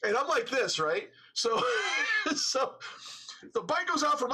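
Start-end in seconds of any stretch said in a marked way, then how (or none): 1.6-2.3 high squealing burst of laughter; shrieking laugh. Real, not acted. Laughing at his own crash.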